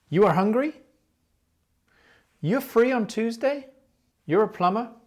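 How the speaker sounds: rising confirmation questions; confirming rise. Shown as a demonstration. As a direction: ask as if you already know. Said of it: "We're asking for confirmation".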